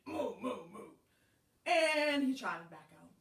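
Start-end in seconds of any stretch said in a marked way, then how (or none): 0.1-0.9 short grumbled cow moos; complaining moos. A narrator's reading. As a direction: moo in complaint, three times. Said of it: "So the cow complained".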